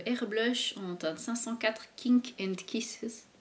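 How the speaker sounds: slow, careful English product name in a French accent. Real, not acted. Vlogger reads an English shade name to viewers in a French accent. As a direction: read out the shade name in her French accent, then apologise for it. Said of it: "Mon accent pourri".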